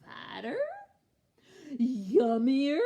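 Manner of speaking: questioning troll, building to a shout; excited shout. A narrator's reading. Troll drooling at a fatter meal, rising shout. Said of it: "he shouted".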